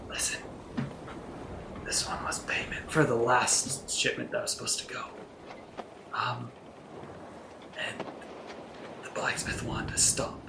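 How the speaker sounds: hushed, voiceless in-character whisper. Pretend. Player confides in Rock about the payment, whispering. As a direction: whisper it secretly into an ally's ear. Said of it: "I'm going to whisper in the ear".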